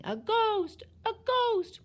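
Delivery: high, loud cries of alarm; shrieked warning; panicked yelling. A narrator's reading. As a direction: yell as a crowd fleeing in panic. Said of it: "everybody yelling".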